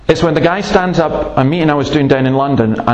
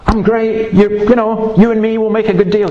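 higher, hammed-up breezy prayer to God; mock cocky prayer; 8 semitones higher than usual. Preacher mocking a casual prayer, higher and breezy. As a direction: play the overfamiliar believer chatting up the Lord. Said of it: "You don't go".

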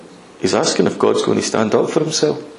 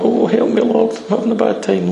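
higher, self-pitying little plea; mock whiny prayer; 9 semitones higher than usual. Pastor mocks a weak, whiny higher prayer. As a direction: whine it, the prayer he rejects.

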